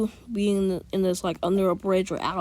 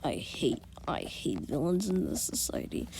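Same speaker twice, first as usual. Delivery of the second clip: much lower, softer contemptuous voice; disgusted sneer; 3 semitones lower, 5 dB quieter than usual. Retold or imitated. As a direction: spit out the disgust quietly. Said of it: "Zuko would be like".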